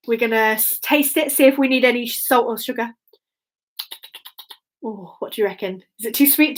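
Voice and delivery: ordinary delivery, nothing marked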